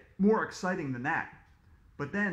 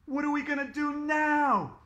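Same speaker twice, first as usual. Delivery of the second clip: high, loud panicked cry; panicked shout; 9 semitones higher, 4 dB louder than usual. A narrator's reading. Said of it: "shouted Neil".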